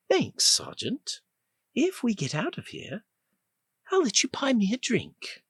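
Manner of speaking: the freed woman flirting with Rip; flirty British woman. Acted. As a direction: tease him coolly in a British accent. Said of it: "She had a clipped accent that sounded vaguely British".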